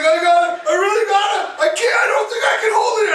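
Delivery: hammed-up panic, very high; mock desperate squeal of words; going crazy. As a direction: imitate a frantic wife, speeding up.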